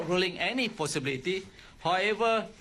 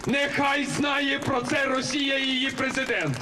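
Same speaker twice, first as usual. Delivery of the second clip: high defiant shout to a crowd; roaring rallying speech; 9 semitones higher, 4 dB louder than usual. Real, not acted. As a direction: roar it out over the rally. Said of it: "Rival rallies continue throughout Ukraine".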